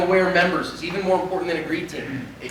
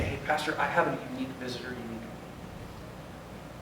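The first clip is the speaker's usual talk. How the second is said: discreet code word; 6 semitones lower, 13 dB quieter than usual. Retold or imitated. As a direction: murmur it like a secret signal. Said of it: "He'd say".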